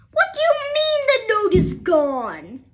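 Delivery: high, loud indignant demand; angry teacher. A narrator's reading. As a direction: scold in disbelief. Teacher angry at Ruby Lee.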